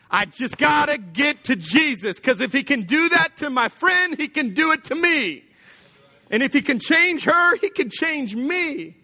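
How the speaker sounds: high, urgent voice of a desperate woman; desperate plea. Acted. Woman rushing to Jesus, desperate and high-pitched. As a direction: plead like you must reach him now.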